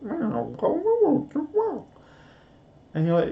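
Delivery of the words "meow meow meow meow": high-pitched talkative meows; imitated cat meowing. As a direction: meow as if trying to talk human. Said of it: "They sort of go".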